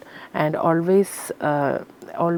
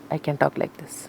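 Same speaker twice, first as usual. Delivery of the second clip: dropped lower and softer to show the bottom; low soft range demo; 4 semitones lower, 5 dB quieter than usual. Shown as a demonstration. Singer showing low range to interviewer, lower and softer. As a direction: sink down and speak gently low. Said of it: "range means".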